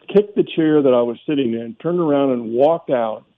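usual speaking voice

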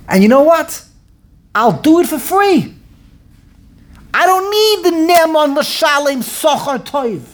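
sudden change of heart, now eager to go; excited agreement. Retold or imitated. Moshe switching from refusal to eager yes, excited.

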